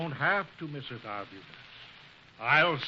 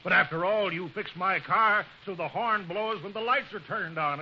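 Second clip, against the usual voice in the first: indignant; 5 semitones higher, 6 dB louder than usual. Acted. Customer complains about botched car repair.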